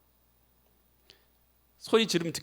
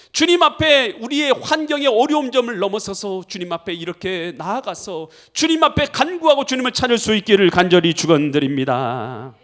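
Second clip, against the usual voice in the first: full-force preaching; 3 semitones higher, 7 dB louder than usual. Real, not acted. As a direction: drive the exhortation home at full volume. (힘차게 외치는 설교)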